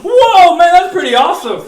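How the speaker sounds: loud, high whoa of wonder; hammed-up amazement at the snake; amazed whoa. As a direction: gasp it out in awe. Reacting to the staff miracle.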